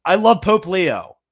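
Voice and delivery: overjoyed declaration of love; gleeful gushing. Real, not acted. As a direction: beam it out like a fan. Gushing over papal document.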